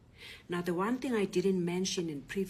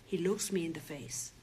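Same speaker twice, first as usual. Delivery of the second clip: usual speaking voice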